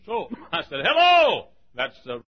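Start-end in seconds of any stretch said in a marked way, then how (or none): 0.8-1.5 loud, high Texas holler of hello; calling out. Retold or imitated. Preacher calls into his open motel room, hollering. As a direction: holler it into the house like a doorbell. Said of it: "ringing the doorbell down in Texas".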